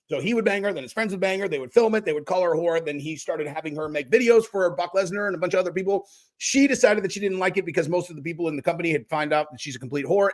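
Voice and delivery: rapid-fire summary, barely pausing; quick recap. Shown as a demonstration. Streamer catching viewers up on the story, quick recap. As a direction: rattle the whole story off at speed. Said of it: "quick recap".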